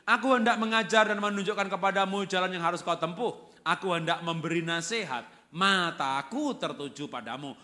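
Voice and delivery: loud, declaimed psalm, slowing at the close; scripture reading. Real, not acted. Preacher reading a Psalm to congregation, loud declamation. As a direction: proclaim the verse at full voice. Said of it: "saya bacakan Mazmur tiga dua".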